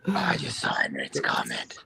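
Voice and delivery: whispery, crackling costume voice; vocal fry. Pretend. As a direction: rasp it out in fry. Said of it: "Your vocal fry is gonna ruin your voice".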